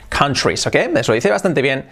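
ordinary talk

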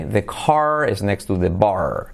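slow American demo, clear R in "car" and "bar"; American accent with R. Shown as a demonstration. Teacher contrasting the US with England, slow American R demo. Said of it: "simplemente se pronuncia la R".

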